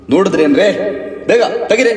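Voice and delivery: high, loud urgent command; barked order. Acted. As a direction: hurry the staff along. Officer orders constables to hurry. (ಜೋರಾಗಿ ಆಜ್ಞೆ)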